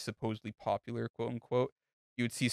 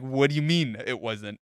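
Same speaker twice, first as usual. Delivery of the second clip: louder, outraged question; indignant disbelief; 3 semitones higher, 8 dB louder than usual. Retold or imitated. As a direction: demand an answer, outraged. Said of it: "I was like".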